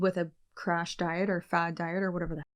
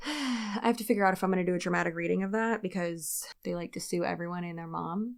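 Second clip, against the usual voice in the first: usual speaking voice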